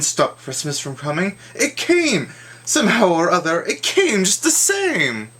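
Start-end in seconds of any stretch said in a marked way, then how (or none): 1.5-5.3 high, rising burst of amazement; astonished exclamation. A narrator's reading. Narrator tells the Grinch's shock, high amazed exclamation. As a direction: cry it out as the shock hits.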